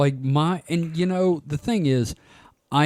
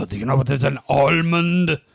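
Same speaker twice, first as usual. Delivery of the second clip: lightly voiced Frenchman, stretching the last word; exasperated Frenchman; 4 dB louder than usual. Frenchman asking a Disney World guest about almonds, mock exasperated. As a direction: imitate an exasperated Frenchman spelling it out. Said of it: "Exasperated Frenchman".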